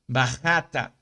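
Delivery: barata with a guttural French R; barata, R from the back of the throat. Shown as a demonstration. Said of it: "Sempre é com som de R". (barata com R francês de garganta)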